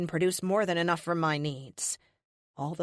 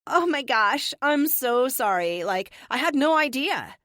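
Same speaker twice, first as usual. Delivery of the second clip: put-on high, loud Valley Girl gush; Valley Girl accent; 8 semitones higher, 5 dB louder than usual. Captive to glaring witch, mocking Valley Girl accent. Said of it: "in my best Valley Girl accent".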